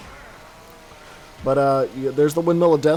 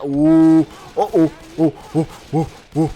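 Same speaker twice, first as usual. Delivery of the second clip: suspenseful oohs; 6 dB louder than usual. Real, not acted. Watching a tense near-down.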